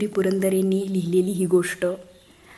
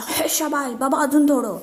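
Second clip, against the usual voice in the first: higher, excited egging on; cheering shout; 8 semitones higher, 3 dB louder than usual. Acted. Son cheering father lifting cupboard, excited higher shout. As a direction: cheer dad on. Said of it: "Sanju ओरडला".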